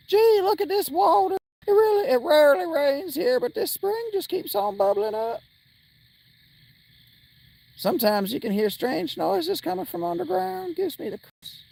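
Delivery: high put-on country twang; hillbilly cowboy voice. Villager telling the player about the spring, in a high country twang. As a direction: play a folksy yokel marveling at the spring. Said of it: "Somebody wanted me to do a cowboy vo".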